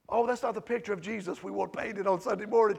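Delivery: ordinary delivery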